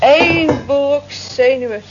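each word drawn out, slow and loud; slow mocking emphasis. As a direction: spell out what a wreck he is, slowly. Mocking the nervous office clerk.

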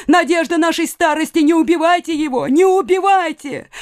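high, desperate plea; crying out. A narrator's reading. Mother begs attacker to spare son, crying out high. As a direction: beg in a high, mounting cry. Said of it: "кричала мать".

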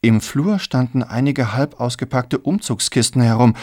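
ordinary delivery, nothing marked

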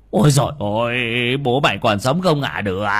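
loud alarmed exclamation; dismayed cry. A narrator's reading. Old man cries out seeing the grey-faced father, alarmed. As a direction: cry out in shock at the sick man. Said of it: "ông Đồng kêu lên".

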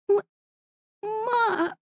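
high, soft and shocked; a stunned breath after the shot; shocked gasp. A narrator's reading. Shot daughter calls her mother, soft and shocked.

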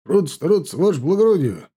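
high, loud chant from the ranks; ringing soldiers' response to an officer; roared troop reply. A narrator's reading. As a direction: bark back as a drilled formation. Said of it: "Вновь рявкнул строй".

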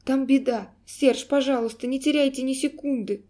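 high, loud, urgent plea; frightened cry. A narrator's reading. Girl begging Serge to hurry, frightened cry. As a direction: urge them on in alarm. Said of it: "крикнула она испуганно".

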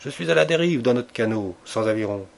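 usual speaking voice

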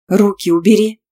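loud, sharp order; loud growl. A narrator's reading. Student ordering Tima to let go, growling loudly. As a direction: snarl it as an angry command. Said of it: "громко прорычала".

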